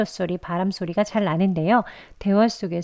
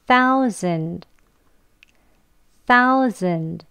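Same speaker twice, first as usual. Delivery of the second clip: slow demo; much slower than usual. Shown as a demonstration. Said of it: "천천히 발음 같이 해볼게요".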